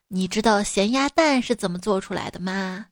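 normal talking voice

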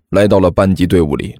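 usual speaking voice

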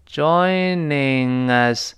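drawn-out, slow joining us; slow demo. Shown as a demonstration. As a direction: stretch JOINING US out slowly, syllable by syllable. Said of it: "这个先慢慢读". (放慢拖长示范)

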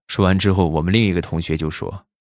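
ordinary delivery

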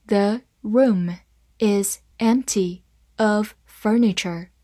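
"The room is empty of furniture", slow, word by word; slow read-along. Shown as a demonstration. Teacher reading the furniture example for learners, slow and separated. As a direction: set each word apart for learners. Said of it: "好，慢慢来读".

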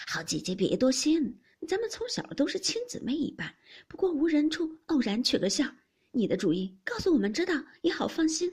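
hasty, apologetic coaxing; placating plea. A narrator's reading. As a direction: plead to smooth it over. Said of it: "忙陪笑央告道".

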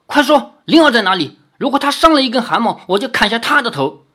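loud, high and fast threat; barked demand. A narrator's reading. Li Xiaoyao threatens the snake demon, barking demand. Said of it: "喝道".

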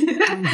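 sudden loud laugh; high burst of laughter. Real, not acted.

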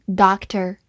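"doctor" the American way, with a final r; American-style "doctor", r-coloured ending; American pronunciation. Shown as a demonstration. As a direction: say "doctor" in American English, open ah vowel. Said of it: "美式发音".

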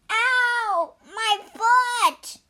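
high, loud acted howl; yelp of pain. A narrator's reading. Bajie after kicking the door, yelp of pain. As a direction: cry out over a hurt foot. Kicked a door, hurts foot.

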